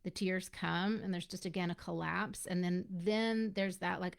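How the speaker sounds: ordinary talk, nothing marked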